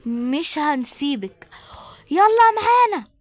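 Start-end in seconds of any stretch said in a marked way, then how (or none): 2.1-3.1 louder, firm order; a police officer ordering the suspect to come along; commanding shout. Acted. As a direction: bark the arrest order.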